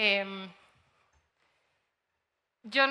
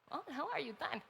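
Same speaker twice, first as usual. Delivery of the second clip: light, soft mock of a friendly AI voice; a touch higher, airy and chirpy; imitating AI voice; 4 semitones higher, 10 dB quieter than usual. Teacher mimics the chatbot greeting her, lightly performed. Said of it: "la voz suena muy real".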